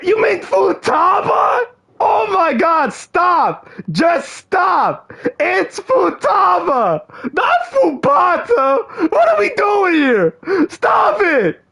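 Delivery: high, whiny, frustrated yelling; exasperated shouting. Real, not acted. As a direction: beg her to stop, near tears of frustration. Exasperated pleading at the mispronunciation.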